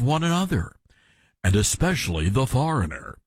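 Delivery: fake news anchor voice. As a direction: mimic a smug TV news anchor. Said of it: "he's got the fake news anchor voice on".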